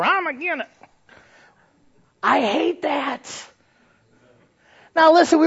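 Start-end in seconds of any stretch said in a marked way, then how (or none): 0.0-0.7 Brother I'm Agin It. Retold or imitated. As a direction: object to everything in a high stubborn squawk. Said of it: "called Brother I'm Agin It".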